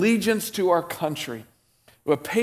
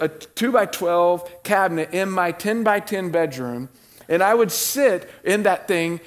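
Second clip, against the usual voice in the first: ordinary talk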